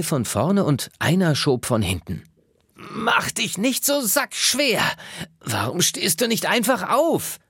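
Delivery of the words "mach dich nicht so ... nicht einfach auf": high, slow, straining character voice; high-pitched kids' story voice, pulling and pushing; pushy animal friends. Acted. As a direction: squeak it out as if heaving a heavy friend. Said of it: "wollten sie wissen".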